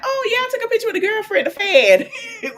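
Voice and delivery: high, sugary fake-sweet voice with laughter; shady mock reply. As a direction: throw shade in a high, sugar-coated tone. Mocking how Nell should have answered.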